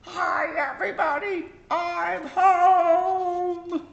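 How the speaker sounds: very high and loud, a full-volume hello; turned right up. Acted. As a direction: blast it home. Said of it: "she turns it right up".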